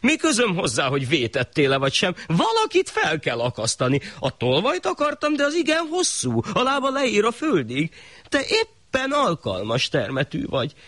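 very high pompous shah voice; the shah brushing off the innocent man; high haughty ruler; callous shah. Acted. Shah dismisses the poor man.

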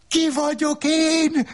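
very high yelled question; the wife screaming her question; yelling. Acted. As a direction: hurl the question out at full voice. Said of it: "Üvöltötte".

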